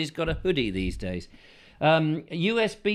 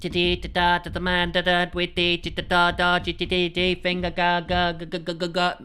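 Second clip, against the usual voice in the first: mock Morse code; 2 semitones higher than usual. Morse code and stutter imitation.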